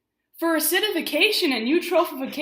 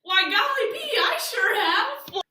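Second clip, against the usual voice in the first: put-on wholesome 1950s kid; 9 semitones higher, 4 dB louder than usual. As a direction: play an eager, squeaky-clean kid. Hammy gee-whiz answer in skit.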